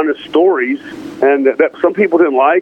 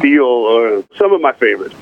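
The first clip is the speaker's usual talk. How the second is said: ordinary talk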